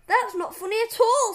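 loud, high-pitched objection; indignant protest. A narrator's reading. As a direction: object loudly as a wronged schoolboy. Boy protests the punishment.

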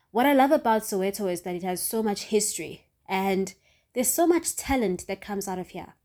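ordinary delivery

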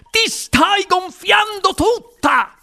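high, slow shrieked cry; alarmed exclamation. A narrator's reading. Mother sees daughter swelling, exclaiming. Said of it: "esclamò la signora Beauregard".

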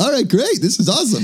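very high, gleeful retold cheer; mock excitement. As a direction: cheer as if the party just began. Retelling delight at an early beer.

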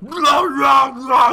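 loud, high snarling dog imitation; mock rabid dog roar. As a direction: bark it out like a crazed rabid dog. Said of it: "but it's still".

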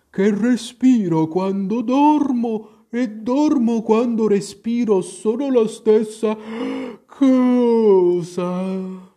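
high, loud, drawn-out sleepy creature voice; sleep-talking dormouse. A narrator's reading. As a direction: stretch the words out as if talking in your sleep. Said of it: "sembrava parlare nel sonno". (voce di ghiro addormentato)

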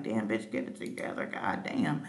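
low, muttered telling-off; mock self-scolding. Host to self over stuttering, low mock scolding. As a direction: scold yourself under your breath, in jest.

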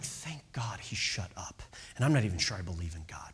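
whispered, low aside of relief; relieved whisper. Retold or imitated. Relieved walkers muttering after the preacher goes quiet, whispered. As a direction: breathe it out like a secret thank-you.

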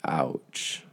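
breathy whispered retold ouch, soft and hushed. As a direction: whisper it as if stunned. Retold reaction to shocking news.

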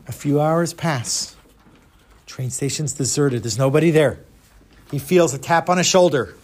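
ordinary delivery, nothing marked

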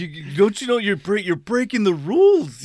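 hammed-up outrage at a rule broken; mock indignant supervisor. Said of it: "It's like".

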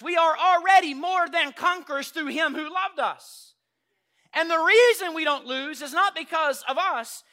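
loud, high triumphant preaching; full-force preaching. Acted. Pastor shouting triumph to the congregation. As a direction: shout the victory.

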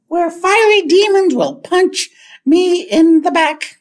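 high, slow, frightened recitation; Ralph Wiggum. Retold or imitated. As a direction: imitate a terrified kid reciting. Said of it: "Because Ralph is really scared".

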